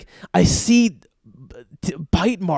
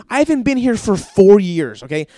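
louder and higher, fed-up complaining; exasperated rant; 5 semitones higher, 5 dB louder than usual. Retold or imitated. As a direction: vent it at the hygienist. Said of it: "And I'm like".

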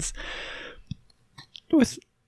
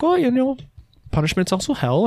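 sly reminder; 10 semitones higher than usual. Retold or imitated. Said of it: "And I'm like".

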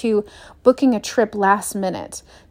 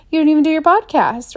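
higher, louder exasperated reproach; mock self-scolding; 6 semitones higher, 4 dB louder than usual. [name] berating herself for skipping episodes, mock exasperation. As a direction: scold yourself half-jokingly.

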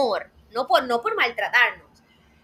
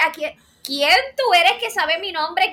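startled Mary; 5 semitones higher, 4 dB louder, slower than usual. Retold or imitated. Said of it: "Apareció un ángel y decía".

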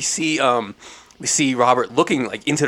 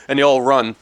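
ordinary talk

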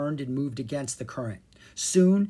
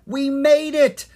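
high, loud, drawn-out cheer; triumphant cry; 12 semitones higher, 10 dB louder, slower than usual. A narrator's reading. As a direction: cry out in victory. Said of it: "Kara cried".

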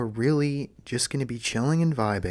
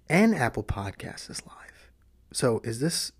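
whispering. Shown as a demonstration. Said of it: "whisper bits through the vlog".

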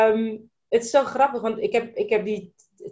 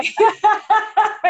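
bright burst of happy laughter; delighted high laugh; 11 semitones higher, 7 dB louder than usual. Real, not acted. Host bursting into a delighted laugh as she thanks her guest. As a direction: laugh out loud with joy. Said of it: "Ik ben helemaal blij".

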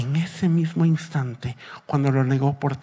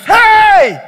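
long, high, loud hail from the shore; full-voiced distant holler; shouting from afar; 11 dB louder than usual. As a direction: call out across the water, holding the vowel. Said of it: "empieza a gritarles".

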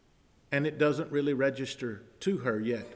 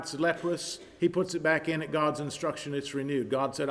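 ordinary delivery

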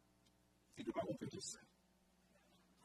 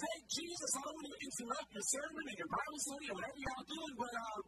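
Martha complaining. Retold or imitated. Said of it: "And she says".

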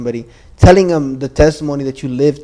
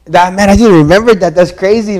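excited outburst; 5 semitones higher, 9 dB louder than usual. Real, not acted. Suddenly remembering the prophecy.

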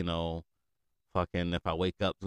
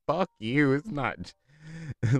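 loud, high retort, breaking into laughter; mock outrage; 8 semitones higher, 6 dB louder, much slower than usual. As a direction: fire back in played indignation. Acts out angry reply to parents.

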